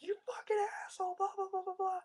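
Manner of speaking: high, loud mock yell at the driver; imitating herself cursing out a driver; retold road rage. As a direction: yell the curse up high, then trail into blahs. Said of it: "I was so mad".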